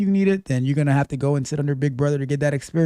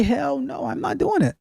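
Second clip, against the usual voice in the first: defiant; 10 semitones higher than usual. Retold or imitated. Defiant refusal quote.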